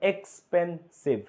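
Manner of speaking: এক্সপেন্সিভ said slowly, syllable by syllable; syllable demo. Shown as a demonstration. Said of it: "তুমি একটা শব্দ বলো তো". (এক্সপেন্সিভ ধীরে ধীরে উচ্চারণ)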